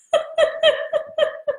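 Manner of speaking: bursts into high giggling; a real, high peal of laughter. Real, not acted. Laughing at her own joke.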